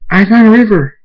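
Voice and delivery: high, loud announcement; eager shout of discovery; excited call. Acted. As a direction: call it out proudly to everyone. Someone excitedly reports a discovery.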